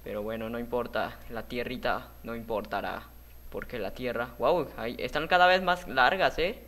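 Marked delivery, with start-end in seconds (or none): none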